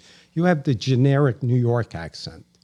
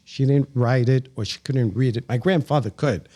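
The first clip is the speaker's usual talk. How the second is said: ordinary delivery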